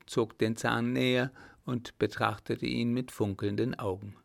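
ordinary delivery, nothing marked

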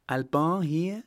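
mother and daughter asking in shock; startled question. A narrator's reading. Mother and daughter learn Alban is here, high startled question. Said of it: "fragten Mutter und Tochter zu gleicher Zeit".

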